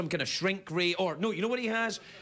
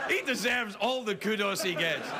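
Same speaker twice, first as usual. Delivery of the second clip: normal talking voice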